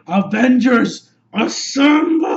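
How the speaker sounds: loud, drawn-out battle call; heroic rallying cry. Retold or imitated. Cap calling the team to battle, rousing shout. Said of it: "Cap finally says the magic words".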